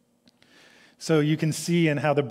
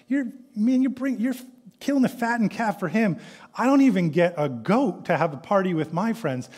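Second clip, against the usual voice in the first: high, put-on whine; whiny older son; 6 semitones higher than usual. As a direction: whine it like a jealous sibling. Mimics the older son whining.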